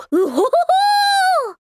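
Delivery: very high, drawn-out hoot of laughter; high whooping laugh. Acted. Refined character bursting out after a rebuke, high whooping laugh. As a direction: whoop with laughter on one long high note.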